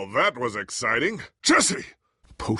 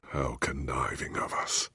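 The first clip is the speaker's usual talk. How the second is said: bitter unvoiced whisper; 9 semitones lower, 8 dB quieter than usual. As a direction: hiss out the shame quietly. Bitter self-reproach.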